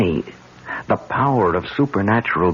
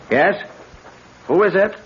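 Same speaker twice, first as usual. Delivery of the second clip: pitched higher, calling out to whoever knocked; calling through door; 13 semitones higher than usual. Acted. Calling to the stranger knocking at the other door, raised and wary. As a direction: call out warily through the closed door.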